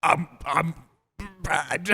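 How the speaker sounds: high, strained growly monster voice. Pretend. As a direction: attempt a silly energy-drink monster. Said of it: "What the fuck was that?".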